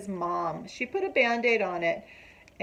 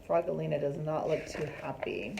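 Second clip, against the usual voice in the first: ordinary delivery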